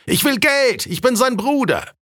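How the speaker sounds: very high-pitched insistent call; heckler calling back. A narrator's reading. Heckler demands money as the duke's brother, high call. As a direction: press the demand across the crowd, high-pitched. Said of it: "lautete die Antwort".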